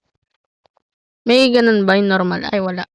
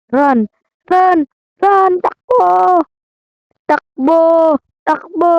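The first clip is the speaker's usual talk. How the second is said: panicked high shouts to flee; while running; 8 semitones higher, slower than usual. Real, not acted. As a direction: yell frantically while escaping. Chased by monster again. (sigaw habang tumatakbo)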